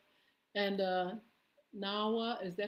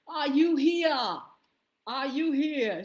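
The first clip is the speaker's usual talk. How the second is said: loud, higher, slow call; searching for Sheila, loud and higher; 6 semitones higher, 10 dB louder, slower than usual. Real, not acted. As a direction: shout across the room for her. Calling out for a viewer.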